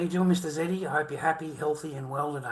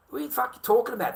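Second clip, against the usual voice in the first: much higher and fast, incredulous; furious outburst; 10 semitones higher, 4 dB louder, faster than usual. Real, not acted. Ranting at his ex-wife, high and outraged. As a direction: blurt it out in outraged disbelief.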